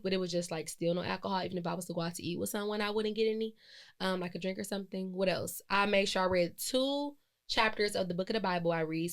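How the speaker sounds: usual speaking voice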